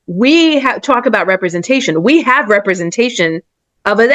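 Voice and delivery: ordinary delivery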